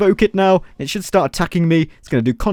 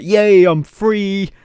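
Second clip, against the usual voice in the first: long drawn-out, higher "yay"; hammed-up cry of freedom; mock minions cheering; 4 semitones higher, 4 dB louder, much slower than usual. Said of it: "They're like".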